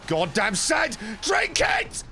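high hype shout. Acted. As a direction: yell it like a rallying cry. Shouted command to self.

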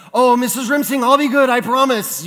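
loud, high pleading of a pupil; imitated child begging the teacher; mock begging. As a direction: plead your way out, hammed up. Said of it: "I remember begging".

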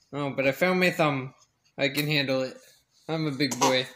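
low, calm voicing of the baby as a tough little guy; lightly put-on low voice for the baby's thoughts; baby's inner voice. Dad voicing his crying baby's thoughts, a low mock-tough voice. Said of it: "He's like".

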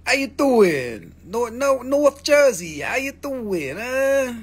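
high, slow, drawn-out Jersey "How you doing"; New Jersey accent. Pretend. Seller teasing Jersey viewers in a mock Jersey accent. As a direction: lay the Jersey accent on thick and hammy.

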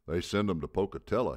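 loud, high holler. A narrator's reading. Old coach to the laughing crowd, hollered. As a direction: holler the punchline to the room. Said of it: "he hollered".

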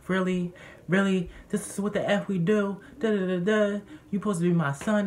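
mock a parent's outraged telling-off; imitated angry dad. Retold quarrel, dad scolding his son.

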